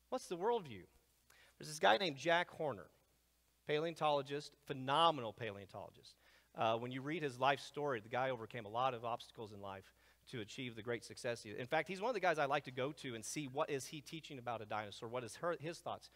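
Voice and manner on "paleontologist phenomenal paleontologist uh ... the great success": normal talking voice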